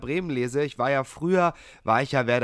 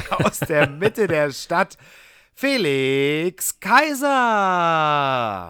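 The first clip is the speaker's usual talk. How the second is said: slow, booming ring announcer; lucha libre announcer; 5 dB louder, much slower than usual. Acted. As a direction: stretch out the name like a fight intro. Booming ring-style introduction of co-host.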